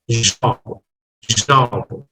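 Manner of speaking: low-pitched British demo of "Job"; British pronunciation. Shown as a demonstration. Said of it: "Is British one".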